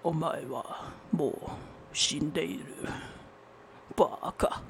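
playful low anime-style menace; anime character. Pretend. Anime villain line after anime laugh.

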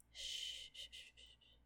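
very soft shushing breath; calm him with a long gentle shh; hushing. Acted. Nurse quieting the patient, soft shushing.

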